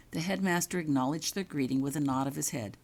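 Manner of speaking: ordinary delivery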